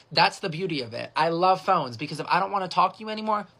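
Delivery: ordinary talk, nothing marked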